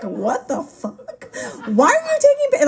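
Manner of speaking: a baffled patient suddenly blurting out in shock; shocked outburst. Retold or imitated. Shocked patient being photographed.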